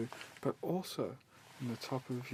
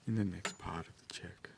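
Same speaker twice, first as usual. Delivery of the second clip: whispering; 6 semitones lower than usual. Acted. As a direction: drop into a whisper. Soft-spoken scalp check roleplay.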